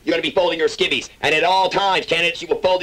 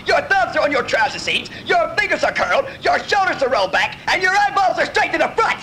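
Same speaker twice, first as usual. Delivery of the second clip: drill instructor yelling; 7 semitones higher than usual. Acted. Drill instructor berating candidate.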